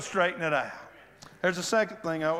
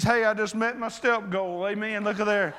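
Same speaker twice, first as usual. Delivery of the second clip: usual speaking voice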